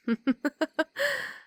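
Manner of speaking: high, lilting victorious laugh; gloating laugh. Acted. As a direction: savor overpowering him. Vampire gloats over overpowered boy.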